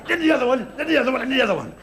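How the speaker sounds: ordinary delivery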